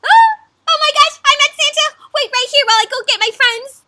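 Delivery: very high, loud starstruck squeal; excited raccoon. Acted. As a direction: squeal like a thrilled little critter. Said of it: "But the raccoon says".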